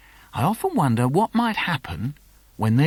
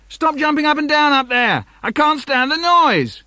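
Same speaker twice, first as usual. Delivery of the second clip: much higher, louder mock exasperation; cross dad; 12 semitones higher, 5 dB louder than usual. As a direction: yell up the stairs at the noise. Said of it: "Dad says".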